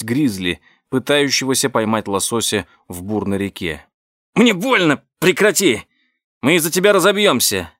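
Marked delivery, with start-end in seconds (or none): 4.3-7.7 panicked shout. Acted. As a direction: yell in panic. Derek in pain, panicking.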